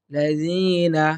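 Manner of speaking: usual speaking voice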